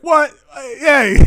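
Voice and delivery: loud, high-pitched indignant outburst; hammed-up offended yell; mock outrage. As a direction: react with exaggerated shock, half laughing. Acts out people's outraged reaction.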